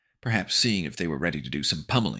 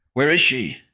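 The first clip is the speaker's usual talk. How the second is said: higher, louder panicked question; frightened boy; 9 semitones higher, 9 dB louder than usual. Acted. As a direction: ask it in alarm.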